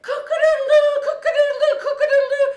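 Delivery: very high, loud cock-a-doodle crow; rooster crowing. Acted. Rooster screams from the gate, loud crowing. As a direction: crow at full volume like a rooster. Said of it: "there is a rooster. And you hear".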